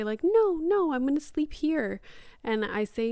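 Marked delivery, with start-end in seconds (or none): 0.2-2.0 high, loud little-kid protest, imitated; imitated toddler's "no", pitched way up; protesting child. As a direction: mimic a small child refusing bedtime. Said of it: "sometimes he'll say".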